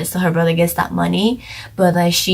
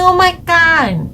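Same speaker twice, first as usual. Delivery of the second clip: shocked oh my God; 11 semitones higher, 4 dB louder, slower than usual. Retold or imitated. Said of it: "I'm like".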